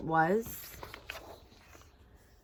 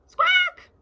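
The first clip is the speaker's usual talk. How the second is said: shrill, far higher hen squawk; 8 dB louder than usual. Acted.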